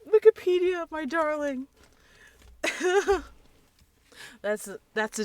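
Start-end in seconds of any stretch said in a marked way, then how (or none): 0.1-1.7 delighted. Real, not acted. Affectionate cry to Wikipedia. 2.5-3.3 high giggle. Real, not acted. Laughs after Wikipedia gush.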